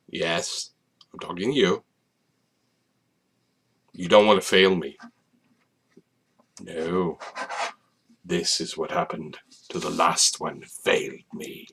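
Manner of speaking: quiet, low mock menace; hushed creepy villain act. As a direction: threaten softly, with long pauses. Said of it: "it's not creepy at all".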